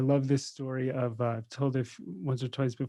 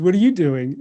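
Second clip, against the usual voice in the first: louder, pointed question thrown back; yogi challenging back; 5 semitones higher, 11 dB louder than usual. Retold or imitated. Yogi firing the question back at Alexander, louder. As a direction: turn the question on the conqueror. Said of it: "And the, and the yogi goes".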